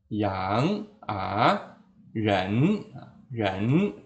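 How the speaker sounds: YANG, A, REN rising from low; second tone. Shown as a demonstration. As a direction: lift each of YANG, A, REN upward. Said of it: "ini nada kedua". (YANG, A, REN nada kedua, naik)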